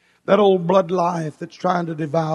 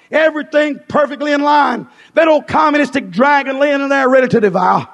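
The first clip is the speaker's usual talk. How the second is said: fervent, driving revival sermon; fiery pulpit proclamation; full-force preaching; 7 semitones higher, 6 dB louder than usual. Real, not acted. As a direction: preach it out hard, raised and urgent.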